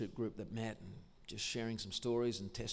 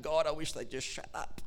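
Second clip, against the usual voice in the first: frustrated; 5 semitones higher than usual. Retold or imitated. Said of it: "so frustrated and so angry".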